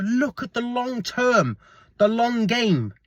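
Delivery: ordinary talk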